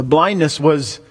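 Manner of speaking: ordinary talk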